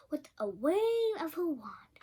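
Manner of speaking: usual speaking voice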